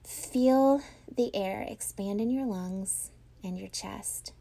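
slow, hushed guided breathing. Acted. Leads a breathing exercise.

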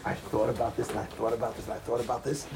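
low, hushed musing; pensive, repeating. Retold or imitated. Rabbi recalling his long pondering, low and pensive. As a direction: murmur it over and over, deep in thought.

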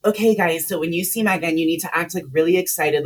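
mock chirpy announcer addressing the crowd; perky event host. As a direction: pump up the crowd like a bubbly organizer. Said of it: "the bitch is like".

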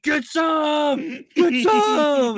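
high, very loud mock battle cry; screaming. Podcaster acting out an attack on a kid, screaming. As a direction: scream it out at full volume, high-pitched. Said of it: "and screaming".

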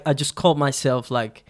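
usual speaking voice

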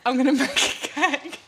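laughed, slow "mech a kek" with short e; laughing vowel imitation. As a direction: copy "mech a kek" slowly through laughter. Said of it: "he goes, tech".

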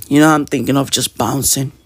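usual speaking voice

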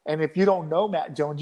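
ordinary delivery

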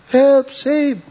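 disciples screaming for rescue in the storm; panicked cry. Retold or imitated.

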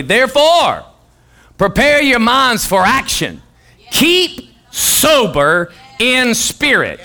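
slow formal Bible reading; scripture reading. Acted. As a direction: read the verse with weight.

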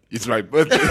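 lightly imitated speaker breaking into laughter; president imitation. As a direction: deliver it as a playful punchline. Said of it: "so he goes".

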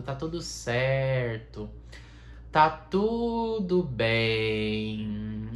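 very slow soothing mantra; mock meditation. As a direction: let each word melt, slowly. Leads a meditation. (mantra de meditação lenta e calma)

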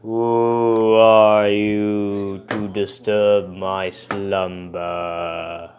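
slow, booming monster; loud, drawn-out monster roar. Acted. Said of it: "as the monster said".

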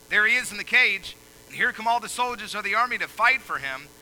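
loud, high preaching cadence; fiery pulpit delivery; full-force preaching. Acted. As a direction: preach it out to the hall.

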